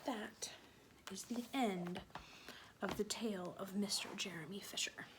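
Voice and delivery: soft, whispered closing words; hushed ending. A narrator's reading. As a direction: let the story fade out in a whisper. Closing the story.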